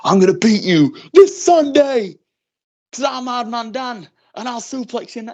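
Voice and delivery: slow, high hammed-up tough guy; mock a serious wrestler promo, hammed up. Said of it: "imagine trying to be this serious character".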